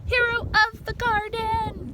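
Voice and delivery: slow, high triumphant shout; high drawn-out victory cry; cheering crowd. Acted. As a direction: cheer for the hero. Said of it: "They're all shouting".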